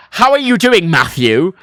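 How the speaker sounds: put-on loud English accent, every word separate, full "Matthew"; telling-off English. English greeting that sounds like telling him off, stern English. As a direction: imitate a scolding English greeting. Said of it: "sounds like I'm being told off".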